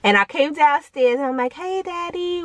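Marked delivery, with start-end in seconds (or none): 1.5-2.4 soft, higher, pitiful greeting; mock sad little voice. Kid greeting dad in the kitchen, mock sad and soft. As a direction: sound pitiful to win dad over. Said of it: "the whole, like, sad".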